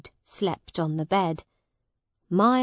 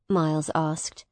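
ordinary delivery, nothing marked

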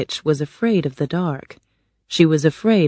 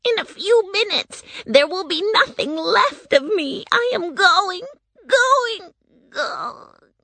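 high witch wail dropping to a faint last word; dying witch's wail; 13 semitones higher than usual. A narrator's reading. Witch melting away completely, wailing then fading. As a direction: cry out, then fade to nothing. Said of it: "the wicked witch cried".

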